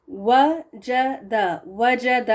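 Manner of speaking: وجد with a rounded lip w, very slow. Shown as a demonstration. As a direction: say وجد with w, drawn out slowly. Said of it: "یہ دیکھیں زبر کے ساتھ واو". (واو گول ہونٹوں سے، بہت آہستہ)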